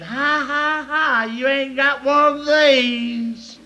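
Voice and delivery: sing-song schoolyard taunt, drawn out; slow mocking kid's ha ha ha. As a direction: rub it in like a bratty boy. Boy taunting girl.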